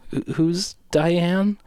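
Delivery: puzzled, drawn out, lost; baffled question. Real, not acted. Confused by neighbour's rules.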